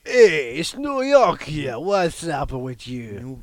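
put-on big, slow, loud New York accent; drawn-out cartoon New Yorker; hammed-up New York accent. Host showing off a New York accent, broad and hammed-up.

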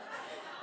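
a short breathy laugh; soft laugh. Real, not acted. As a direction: laugh quietly under the breath. Laughs telling story.

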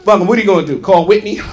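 normal talking voice

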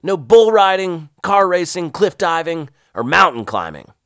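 loud, high shouted list; gruff sergeant. Retold or imitated. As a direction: bark each banned thing out. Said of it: "Tubby continued".

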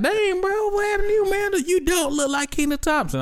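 very high put-on voice; astonished friends. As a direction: squeal in mock amazement. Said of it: "Cats gonna be like".

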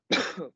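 a short sick cough. Real, not acted. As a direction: cough once, then apologise. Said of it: "pardon, excusez-moi, je suis un peu malade".

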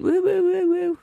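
high siren wail woo woo; alarm imitation. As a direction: mimic a shop alarm going off. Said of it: "It went".

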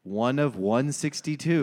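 usual speaking voice

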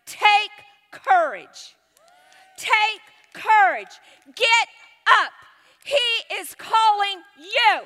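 slow, loud, high proclamation; calling out. Acted. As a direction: call him to his feet, word by word. Calling the blind man over.

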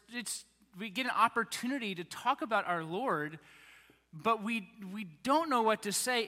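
usual speaking voice

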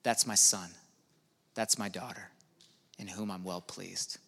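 low, soft, fading to near hush; hushed loving declaration of a child; God speaking tenderly. Retold or imitated. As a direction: say it like a proud father's blessing. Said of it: "He talks like this".

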